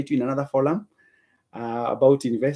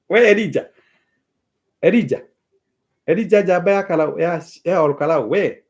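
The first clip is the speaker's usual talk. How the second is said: calling out; 4 semitones higher, 6 dB louder than usual. Real, not acted. Teasingly calling out to Elijah.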